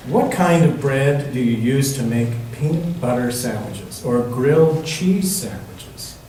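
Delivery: usual speaking voice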